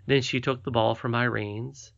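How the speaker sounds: usual speaking voice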